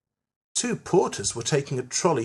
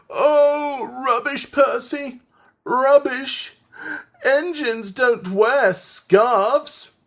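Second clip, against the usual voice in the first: high, louder, drawn-out exasperated engine; Henry fed up; 10 semitones higher, 4 dB louder, much slower than usual. A narrator's reading. Henry had had enough of Percy, exasperated high scolding. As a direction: scoff wearily in a raised character voice. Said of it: "Henry had had enough".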